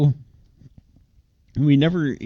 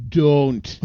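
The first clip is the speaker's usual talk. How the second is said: put-on Canadian accent. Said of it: "Canadian accent".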